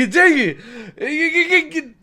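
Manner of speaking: high, cracking laugh through the words; laughing dang it. Real, not acted. Vlogger laughing at a rival's newsletter, high and cracking. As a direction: laugh it out in disbelief. Reacting to the news.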